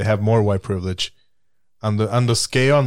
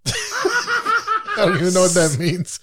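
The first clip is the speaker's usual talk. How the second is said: laughing; much slower than usual. Real, not acted. Laughing at friend's remark.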